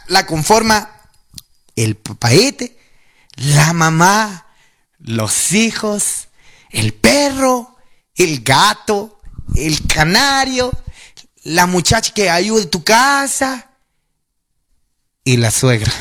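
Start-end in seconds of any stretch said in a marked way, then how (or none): 1.8-13.7 slow listing. Acted. Listing the family slowly.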